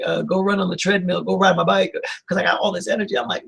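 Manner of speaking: ordinary talk, nothing marked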